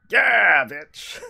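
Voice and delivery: high shout of victory; triumphant yell. Real, not acted. Gamer yelling in triumph at a correct answer. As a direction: crow over the right answer.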